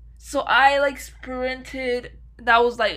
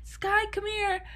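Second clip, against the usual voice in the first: high-pitched imitated calling out to the dog; 10 semitones higher, 5 dB quieter than usual. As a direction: owner calling her escaped dog, mock frantic. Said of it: "you just hear me".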